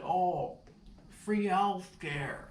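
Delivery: high, slow mock suggestion; mocking coworker. Boss mimicking coworker's pitch, high and slow. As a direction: drawl it out like a timid intern. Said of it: "somebody else said".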